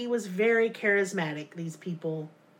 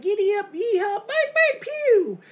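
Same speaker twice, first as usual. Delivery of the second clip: high, loud hammed-up country yokel voice; 12 semitones higher, 6 dB louder than usual. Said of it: "classmates are all talking like".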